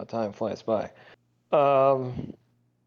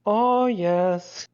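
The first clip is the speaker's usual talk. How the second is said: excited; 7 semitones higher, 4 dB louder than usual. Retold or imitated. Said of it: "it's like".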